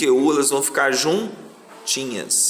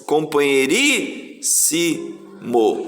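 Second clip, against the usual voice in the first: very slow, with breaks between the last syllables; much slower than usual. Shown as a demonstration. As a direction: break it apart to show the split. Teacher shows where syllables split. (separando as sílabas, bem devagar)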